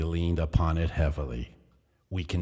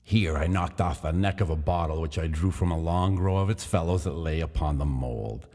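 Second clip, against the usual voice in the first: ordinary delivery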